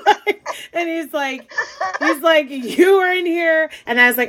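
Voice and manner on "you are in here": slow, high-pitched accusation; mock-accusing, each word leaned on; retold indignation. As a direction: imitate an indignant man, drawing out the words. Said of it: "like, in a rage".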